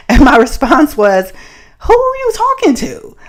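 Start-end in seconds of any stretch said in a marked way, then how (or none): 1.8-3.2 going off on him. Retold or imitated. She turns around and goes off on the catcaller, higher indignant yelling. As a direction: tell him off in the parking lot. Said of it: "I went off on him".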